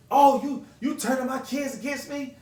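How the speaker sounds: put-on mother accusing him of turning her kids; imitating Trina. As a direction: play her outraged.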